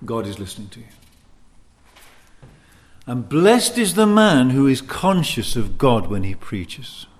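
ordinary delivery

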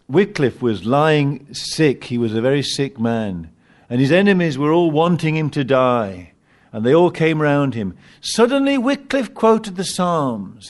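usual speaking voice

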